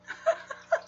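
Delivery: soft, breath-only high laugh; quiet helpless tittering; amused giggle. Real, not acted. Streamer still tickled by viewer's story, giggling.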